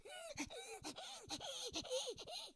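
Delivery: a long high whining cry like an eager dog; imitated dog whine. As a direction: whine like a dog straining to attack. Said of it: "Your dog is like".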